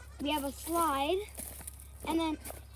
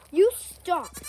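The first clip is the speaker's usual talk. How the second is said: sharp loud yell at the goat; 4 semitones higher, 11 dB louder than usual. Real, not acted. Girl yelling at her goat Zeus to stop, loud real yell. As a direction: yell it to make the goat quit biting. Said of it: "Sorry, I'm just yelling at Zeus".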